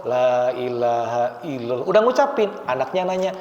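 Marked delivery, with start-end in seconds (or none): 0.0-1.9 lower, softer, slow la ilaha illallah; the dying man barely gets the words out; a hint of a dying man. Dying man saying the shahada, faint and slow. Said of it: "orang yang sakaratul maut".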